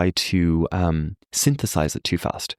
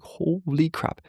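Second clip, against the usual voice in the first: high, hushed startled gasp of words; startled holy crap; 10 semitones higher, 3 dB quieter, much slower than usual. Retold or imitated. Husband taken aback by his angry wife, hushed alarm. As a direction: pitch it up and pull it back in shock. Seeing wife's fangs out.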